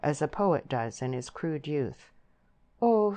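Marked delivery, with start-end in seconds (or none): 2.8-3.2 higher, hopeless sighing "oh". A narrator's reading. Deb answering her suitor, hopeless. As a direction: voice lifted in helpless protest. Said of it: "said Deb hopelessly".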